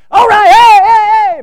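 ball game cheering. Shown as a demonstration. Preacher showing how he cheers at a ball game, wild shouting. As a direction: whoop like a fan in the stands. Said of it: "I can cheer with the best of them".

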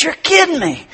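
high, astonished buyer voice; amazed disbelief. Acted. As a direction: gasp it out like you can't believe it. Joke buyer amazed at watch.

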